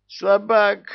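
high, loud furious shriek. Acted. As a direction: screech the insult. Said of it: "взвизгнул шайтан".

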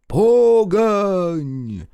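high, loud drawn-out echo; crowd bark. A narrator's reading. Crowd echoes the leader, high shouted chant. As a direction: cry it back as a chanting crowd. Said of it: "гаркнула в торе ему толпа".

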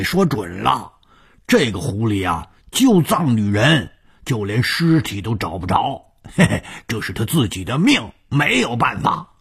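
high, animated character voice; scheming henchman. Acted. 武刚 telling 洪金 the search failed, slyly.

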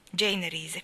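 ordinary delivery

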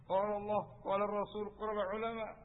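high, loud hectoring recitation; mock finger-pointing preacher. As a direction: ham up a preacher jabbing his finger at an old man. Meniru penceramah yang menuding-nuding. (meniru penceramah menuding dengan suara tinggi dan keras)

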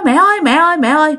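high, urgent retold call; alarmed calling. Daughter-in-law rushing to choking mother-in-law, alarmed calling.

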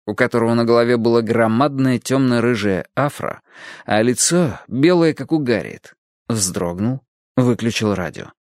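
ordinary delivery, nothing marked

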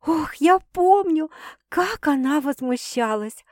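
slow, high, smiling moan; amused groan.

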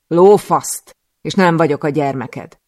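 loud, furious swearing; angry outburst. Acted. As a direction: spit the refusal back at him. Furious retort to the master. (dühös, hangos káromkodás)

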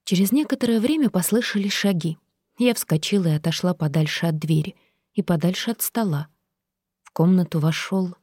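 ordinary delivery, nothing marked

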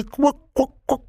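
high, slow, sinister croaking laugh; sinister croaking cackle. A narrator's reading. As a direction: cackle like something inhuman. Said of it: "Тип позволил себе зловеще заквахтать".

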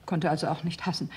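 normal talking voice

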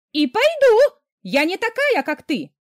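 a sister screaming at her mother; screaming back. A narrator's reading. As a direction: scream it in defiance.

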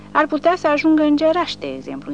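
grinning jab. A narrator's reading. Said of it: "intervine Porta exhibându-și unicul dinte".

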